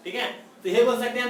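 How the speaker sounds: ordinary talk, nothing marked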